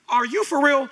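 startled disbelief. Acted. Ananias questioning God's order, startled disbelief. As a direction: jump up in pitch in disbelief.

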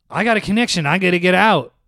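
put-on high, loud panicked old man; urgent, pushing to get off the plane; frantic old man. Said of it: "he looked at me and goes".

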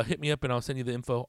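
ordinary talk

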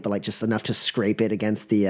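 ordinary talk, nothing marked

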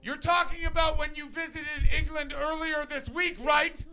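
high, loud mocking local voice; snooty local. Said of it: "a guy two tables over went".

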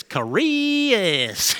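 slow Tennessee twang stretching the name out; southern twang. Shown as a demonstration. Preacher demonstrating to the congregation how Tennessee folk say a name, in a slow Southern twang. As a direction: drag "Chris" into three syllables, Southern style, as "Charias". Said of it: "they talk with a southern accent".

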